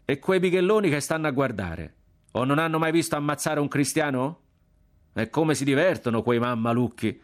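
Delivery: high, animated Florentine street banter; working-class Florentine accent. A narrator's reading. Condemned boys jeer at onlookers among themselves, working-class Florentine accent. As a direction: jeer in broad Florentine, pitched well up. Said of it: "Parlavano con l'accento popolano di San Frediano".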